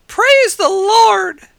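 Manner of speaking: put-on high-pitched old lady hollering, drawn out; jubilant shout. A narrator's reading. Little old lady calling to the sky each morning, shouting. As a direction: shout it skyward, long and very high. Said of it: "and shout".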